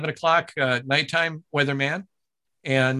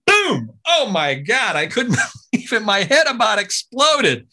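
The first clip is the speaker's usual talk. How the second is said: much higher, louder, laughing disbelief; excited; 7 semitones higher, 5 dB louder, slower than usual. Real, not acted. Announcer recalling landing his dream gig, thrilled. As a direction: burst with joy at the news.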